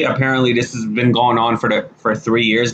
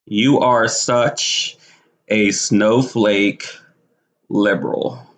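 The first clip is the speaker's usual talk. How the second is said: drawn out, slow and lower, word by word; slow deliberate insult; 4 semitones lower, much slower than usual. Acted. Slowly dictating her insult tweet to the man. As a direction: spell it out slowly to mock him.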